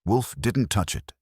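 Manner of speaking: ordinary delivery, nothing marked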